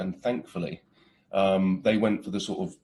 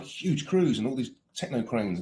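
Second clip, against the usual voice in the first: ordinary talk, nothing marked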